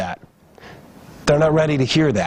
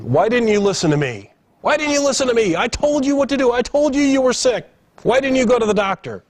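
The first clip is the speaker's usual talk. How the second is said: retold yelling; 10 semitones higher, faster than usual. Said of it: "Yelling, shouting at".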